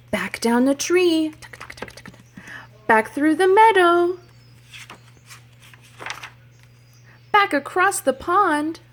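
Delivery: loud, high hurry to run away; urgent excitement. Acted. Teacher urging kids to run from the beehive, loud and high. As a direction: sound like you must escape now. Fleeing the bees in story.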